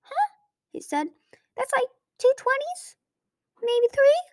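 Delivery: very high dim-witted dragon voice; cheerful clueless dragon, pitched high; goofy high voice. A narrator's reading.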